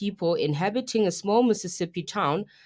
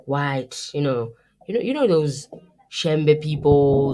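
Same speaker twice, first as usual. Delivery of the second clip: ordinary delivery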